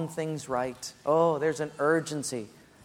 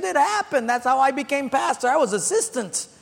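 higher, louder insistent pastor voice; 10 semitones higher, 7 dB louder than usual. Retold or imitated.

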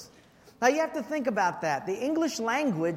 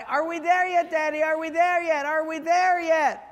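much higher, building to a shout; escalating nagging kid; 7 semitones higher, 4 dB louder than usual. Retold or imitated. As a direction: whine harder each time until yelling.

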